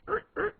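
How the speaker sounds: high squeaky twisting noise; two short, high mimed squeaks; imitated squeak. Putting them in an imaginary jar, with high imitated squeaks. Mimes screwing balls into jar.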